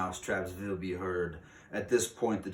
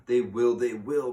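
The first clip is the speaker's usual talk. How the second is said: cried; 4 semitones higher, 9 dB louder than usual. Acted. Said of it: "cried the audience".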